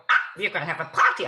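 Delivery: put-on bubbly old lady; brisk cheerful rush; Doctor Ruth voice. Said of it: "ends of her sentences go up".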